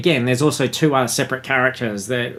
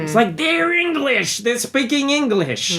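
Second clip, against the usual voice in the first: 1 exasperated outburst; 12 semitones higher than usual. Real, not acted. Ranting about a podcast host.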